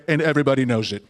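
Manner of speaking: low, matter-of-fact politician impression; Donald Trump. Comic showing how he seals made-up facts, low smug certainty. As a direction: cap it with smug, level certainty. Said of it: "he'll say".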